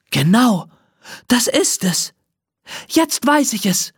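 a touch of triumphant glee; excited cry. Acted. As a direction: burst out with the idea. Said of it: "ruft sie".

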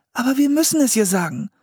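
fast, high-pitched insistence; insistent. A narrator's reading. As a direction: press the point quickly in a high voice. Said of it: "beharrte Anne".

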